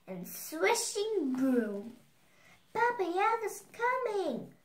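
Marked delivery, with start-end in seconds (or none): 2.7-4.5 high-pitched little doll's cry; cried warning. A narrator's reading. Little doll warns fleeing Tasha, high urgent cry. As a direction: pitch it far up and call the alarm. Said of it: "cried the little doll".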